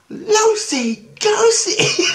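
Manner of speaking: very high, loud, drawn-out squeal; squeaky kid stretching out both words; mimicked little kid. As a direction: shriek it back like a delighted child. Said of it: "She goes".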